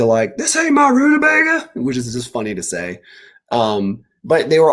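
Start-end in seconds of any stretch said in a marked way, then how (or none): 0.4-1.7 very high, squeaky put-on voice; silly character voice. Author doing a goofy high voice to show a funny line. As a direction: squeak it out like a cartoon critter. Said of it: "I wanted to hear somebody say".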